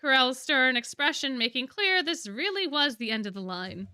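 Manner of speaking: usual speaking voice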